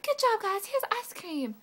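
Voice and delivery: high, loud mimicking of an organizer; mock announcement. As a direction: imitate them calling out to the gym. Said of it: "listening to you be like".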